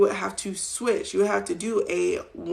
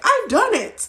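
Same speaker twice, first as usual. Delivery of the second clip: triumphant; 14 semitones higher, 7 dB louder than usual. Retold or imitated. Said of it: "I was like".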